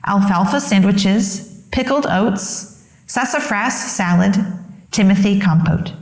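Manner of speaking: ordinary delivery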